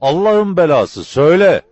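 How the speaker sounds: loud, high, exasperated curse; impatient, high-pitched bellowing; angry shout. A narrator's reading. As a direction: yell the demand in a fury. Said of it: "diye bağırdı".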